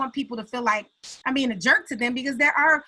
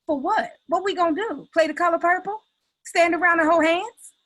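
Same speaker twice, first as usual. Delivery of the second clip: retold incredulous comeback, pitched higher; mock-questioning, voice raised in pitch; incredulous retort; 5 semitones higher, 3 dB louder than usual. Speaker retells her reply about the boycott, incredulous.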